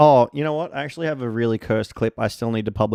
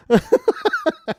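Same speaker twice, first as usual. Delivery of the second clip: high squeal laugh; 4 dB louder than usual. Real, not acted. Burst of laughter.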